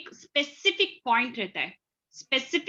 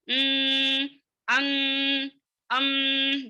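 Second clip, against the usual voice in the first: a humming mmm sound through the nose for ghunna; nasal hum; 3 semitones higher, much slower than usual. Shown as a demonstration. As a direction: hum it the way a teacher demonstrates ghunna. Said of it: "بن بنانا". (ناک سے گنگناتی غنہ کی آواز)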